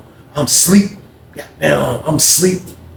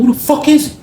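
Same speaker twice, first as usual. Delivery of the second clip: incredulous demand; 13 semitones higher, 3 dB louder than usual. Retold or imitated. Neil demanding about Zach.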